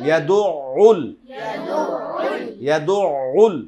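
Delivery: ইয়াদুউল loud with the ain held; teacher leads the repetition; drill repetition. Shown as a demonstration. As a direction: call it out for the class to repeat. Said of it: "সবাই একবার বলি".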